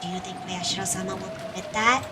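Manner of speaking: quiet menace. Acted. Questioning Yoshimura about Oyashiro-sama, quietly ominous.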